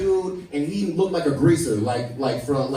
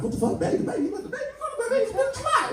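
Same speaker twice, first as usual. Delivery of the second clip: shocked babbling; faster than usual. Retold or imitated. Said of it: "we're all like".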